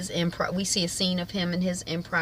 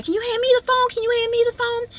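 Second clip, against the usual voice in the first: very high, loud squeaky urgency; hammed-up high-pitched demand; urgent request; 5 dB louder, faster than usual. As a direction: act out a flustered, insistent plea. Flustered woman demands phone mid-scene.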